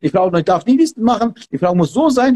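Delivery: ordinary delivery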